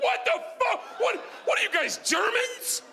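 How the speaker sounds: high-pitched hammed-up outburst; horrified disbelief. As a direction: exclaim in mock horror, pitch way up. Retells his shocked reaction to trick.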